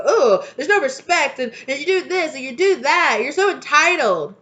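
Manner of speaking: high, loud whiny mock of elders; hammed-up whining elder; mocking complaint. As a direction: sneer out their complaints. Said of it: "turn around and go".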